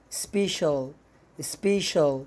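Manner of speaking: special with a clear sh, spesh-ul; teacher demo, special with sh; sh sound in special. Shown as a demonstration. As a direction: say special as spe-SHUL, never with an s. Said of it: "هتنطق شين".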